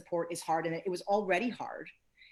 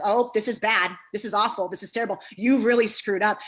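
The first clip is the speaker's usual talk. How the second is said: mock harsh friend; 3 semitones higher, 9 dB louder than usual. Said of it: "imagine a friend that you call".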